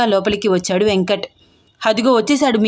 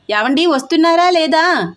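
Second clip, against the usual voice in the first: shouting; 6 semitones higher than usual. A narrator's reading. Aunt yelling at her husband from the auto, higher. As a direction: yell impatiently for him to come. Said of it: "అరిచింది".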